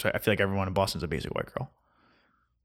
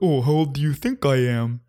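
coy mock girl voice; 3 semitones higher, 3 dB louder than usual. Mocking girls asking age.

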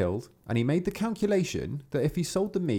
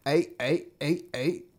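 croaking frogs; 5 semitones higher, slower than usual. A narrator's reading. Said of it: "stood to their".

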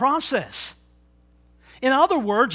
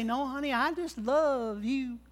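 soft, slow sweet-talk, quieter; tender reassurance; 3 semitones higher, 6 dB quieter than usual. Retold or imitated. Husband soothing his suspicious wife, softly and slowly. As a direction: gently soothe her with love. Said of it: "You say".

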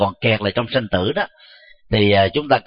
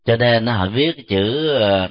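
ordinary talk, nothing marked

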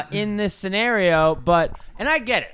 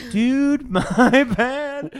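high, loud mock apology; sheepish apology; 6 semitones higher, 4 dB louder, much slower than usual. Truck driver to man he nearly hit, laughing apology. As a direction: call it out with a laugh. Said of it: "and I was like".

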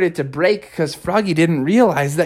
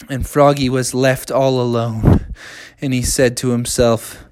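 ordinary delivery

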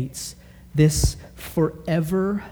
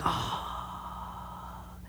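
soft breathy sigh; 15 dB quieter than usual. Shown as a demonstration. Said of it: "not like a slow song where you're like".

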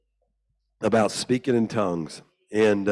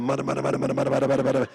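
put-on boy trying tongues; 4 semitones higher, much faster than usual. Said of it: "So I'm going".

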